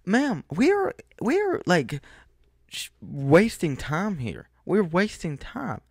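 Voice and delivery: high-pitched, drawn-out complaint; slowed, whiny mock exasperation; exasperated plea. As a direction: beg her to hurry, fed up. Pleading with the slow clerk.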